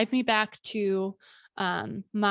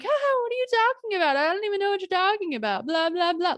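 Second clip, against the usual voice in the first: much higher, airy feigned cluelessness; playing dumb; 10 semitones higher than usual. To her attacker, terrified but acting clueless and breezy. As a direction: brush it off with a fake breezy laugh.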